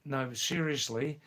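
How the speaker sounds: ordinary talk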